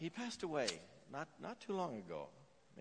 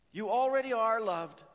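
higher and louder, pressing on already; loud emphatic preaching; 9 semitones higher, 10 dB louder than usual. Shown as a demonstration. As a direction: proclaim it to the whole room. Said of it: "repeated for emphasis".

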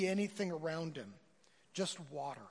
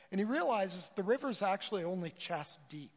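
ordinary delivery

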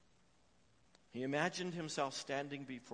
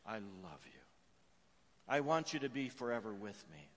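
ordinary delivery

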